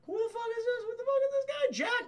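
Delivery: very high, put-on eavesdropper voice; nosy waitress. Three-eyed waitress listening in on Jack, high and suspicious. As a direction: squeak it to yourself, suspicious.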